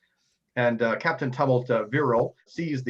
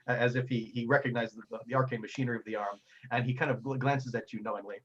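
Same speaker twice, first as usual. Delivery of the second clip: usual speaking voice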